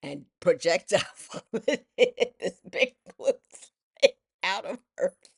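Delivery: laughing through the telling. Real, not acted. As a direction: giggle through the words.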